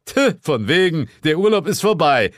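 high, loud curt verdict; officious superior's brush-off; dismissive boss. Acted. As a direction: scoff and cancel the holiday.

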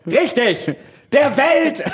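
high laughing shout; shouted punchline. Retold or imitated. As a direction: punch the answer out loud. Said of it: "Comedy-Skit von diesem Typen".

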